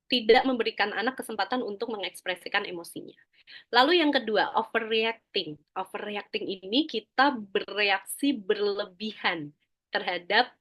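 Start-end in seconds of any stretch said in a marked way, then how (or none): none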